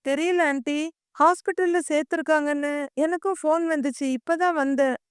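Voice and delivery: plain reading voice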